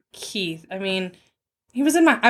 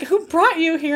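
concerned stripper; 8 semitones higher than usual. Retold or imitated. Retells stripper's questioning.

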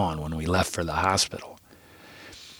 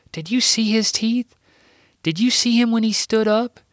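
put-on young Sam's voice; 10 semitones higher than usual. A narrator's reading. Sam the child speaks.

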